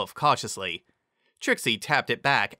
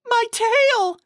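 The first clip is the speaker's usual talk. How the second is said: much higher and louder cry; shrieking in dismay; alarmed shriek; 7 dB louder than usual. A narrator's reading. Carrot Top discovers her tail is huge too, alarmed shriek. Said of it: "she exclaimed".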